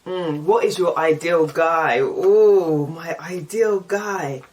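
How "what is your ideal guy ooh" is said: ordinary talk, nothing marked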